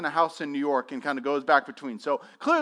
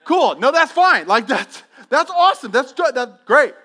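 forced enthusiasm; 7 semitones higher, 10 dB louder than usual. Retold or imitated. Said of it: "I was like".

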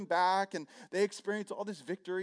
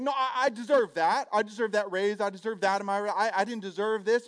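loud, raised, insisting on what's owed; a raised, wounded protest, I deserve that; mock outraged employee, louder and higher; indignant entitlement; 4 semitones higher, 7 dB louder than usual. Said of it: "you're like".

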